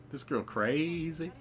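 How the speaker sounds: slow, stunned comment; slow disbelieving aside. Real, not acted. Player reacting to Olivia, slow and disbelieving. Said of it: "aside on Olivia".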